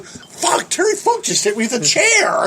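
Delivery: high-pitched mock astonishment; retold shocked exclamation. Teller reacts to the chair hit, high shocked exclamation. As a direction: blurt it high, as if just hit.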